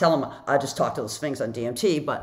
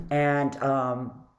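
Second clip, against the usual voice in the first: ordinary talk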